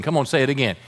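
ordinary talk

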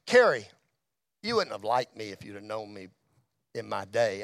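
low rumble, kept quiet; low, subdued quote. Retold or imitated. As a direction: drop into a very low, soft register. Dying man's words retold, low and subdued.

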